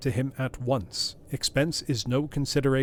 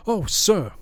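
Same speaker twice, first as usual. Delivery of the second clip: loud, higher cry of protest; 9 semitones higher, 8 dB louder than usual. A narrator's reading. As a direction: cry out, pleading. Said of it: "cried Poole".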